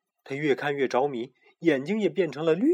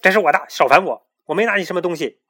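loud, higher defensive shout; 6 semitones higher, 10 dB louder, faster than usual. A narrator's reading. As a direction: yell them off. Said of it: "大喊大叫". (大声嚷嚷地辩解)

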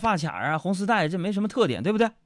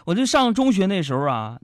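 ordinary talk

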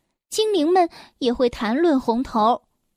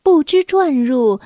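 slow, measured classical verse reading; poem recitation; slower than usual. Acted. Storyteller reads a Tang poem to children, slow deliberate recitation. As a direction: recite slowly, with pauses between phrases.

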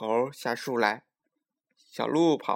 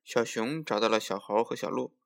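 ordinary delivery, nothing marked

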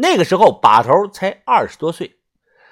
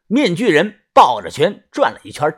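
plain reading voice

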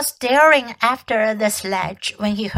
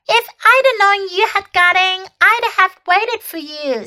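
put-on higher, louder bright child; too happy to notice; Lucy answering her brother cheerfully; happy girl; 9 semitones higher, 4 dB louder than usual. A narrator's reading.

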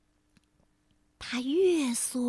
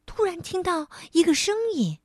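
plain reading voice

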